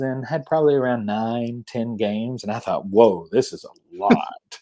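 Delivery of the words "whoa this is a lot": amazed. Retold or imitated. Recalls being overwhelmed by games.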